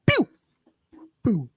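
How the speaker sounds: normal talking voice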